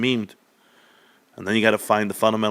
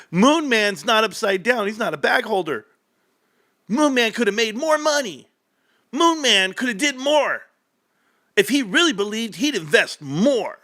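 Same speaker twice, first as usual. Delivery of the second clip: mocking critics; 10 semitones higher than usual. Streamer mocking online haters, high and whiny. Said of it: "They said".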